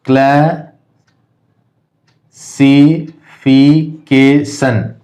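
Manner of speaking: Classification very slow as cla, si, fi, ke, shan with long gaps; teacher breaking classification into five separate beats; syllable by syllable. Shown as a demonstration. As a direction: sound out classification one drawn-out syllable at a time. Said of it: "इसी प्रकार है".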